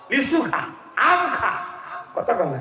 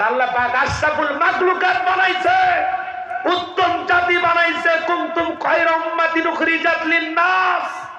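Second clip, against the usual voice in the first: a preacher thundering praise of mankind's honour; fiery preaching; 9 semitones higher than usual. Acted. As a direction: preach it to the crowd at full force. Preaching at full force.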